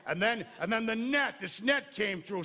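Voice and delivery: loud, animated bug recounting a narrow escape; big storytelling voice for a little bug; bug telling its tale. Pretend. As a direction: boast to the other bugs, loudly. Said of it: "Can you imagine that bug talking to the other bugs".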